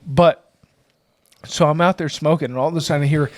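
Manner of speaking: ordinary talk, nothing marked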